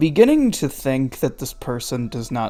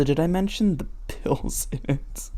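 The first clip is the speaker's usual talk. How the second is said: ordinary delivery, nothing marked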